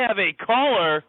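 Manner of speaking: slow, showy radio host announcement; milk it like a talk-show host; radio host announcing. Pretend. Hosting a pretend radio show, slow announcer voice.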